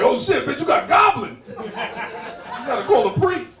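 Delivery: loud, high comic panic; mock terror. Visitor spots goblin-like dog, high panicked yell. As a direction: cry out as if seeing a demon.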